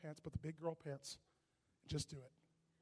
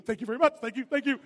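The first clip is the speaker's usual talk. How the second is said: mock queen; 4 semitones higher, 12 dB louder than usual. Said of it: "You wave like the queen".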